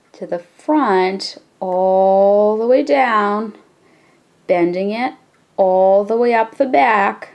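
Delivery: very slow, stretched-out instruction. Shown as a demonstration. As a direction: draw each word out along with the step. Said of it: "repeat this a little slower".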